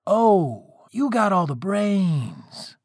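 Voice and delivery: high, drawn-out sarcastic echo; mocking echo. A narrator's reading. Father echoing his son's boast back, mocking. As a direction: throw the boast back in mock wonder.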